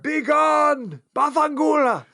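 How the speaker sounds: high theatrical banishing shout; hammed-up Italian cursing, pitched high; mock dismissal shout. Acted dismissal with Italian curse.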